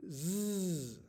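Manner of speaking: "Zzz" as a long voiced buzz; isolated letter sound. Shown as a demonstration. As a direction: hold the z of "Zzz" steadily. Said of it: "we can make this sound".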